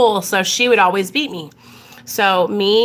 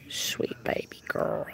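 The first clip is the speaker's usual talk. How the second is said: very soft, slow whisper; 14 dB quieter than usual. Real, not acted. Owner murmuring to her baby girl dog, tender whisper. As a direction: whisper it lovingly to a pet.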